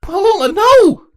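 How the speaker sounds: very high, loud frantic cry; shouted. Acted. Saronis shouts at her little sister to stop, loud and high. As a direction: scream her sister's name in alarm. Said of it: "Saronis shouted".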